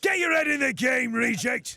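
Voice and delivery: loud, high northern bark; northern veteran shout. Shown as a demonstration. Voice actor barking at a recruit in his northern character voice. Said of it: "go talks like this".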